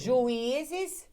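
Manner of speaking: "Juízes" with a long, high "í"; stressed syllable demonstrated. Shown as a demonstration. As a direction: call out "Juízes" loudly, lifting the "í". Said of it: "Agora, quando vai juíza".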